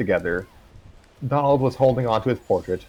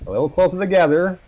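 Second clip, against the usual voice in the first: higher, louder direction; higher, louder call; photographer call; 8 semitones higher, 5 dB louder than usual. A narrator's reading. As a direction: coax the group closer. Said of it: "said Chester".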